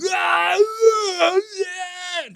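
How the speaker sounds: high, loud strangled scream; straining yell. Retold or imitated. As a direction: scream until you see stars. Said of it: "I'm going".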